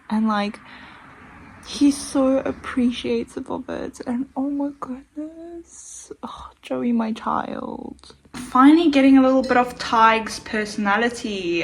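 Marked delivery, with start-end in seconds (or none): 4.1-8.2 swooning whisper. Real, not acted. Reader cooing over Joey in a high whisper. 8.3-11.6 louder, higher thrilled burst; excited. Real, not acted. Reader gushing to viewers about Joey, louder.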